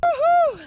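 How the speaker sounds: very high, loud whooping cheer; whoop of joy. Real, not acted. Hiker reaching the top, joyful whoop. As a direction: let out a big triumphant whoop.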